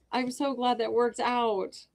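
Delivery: ordinary delivery, nothing marked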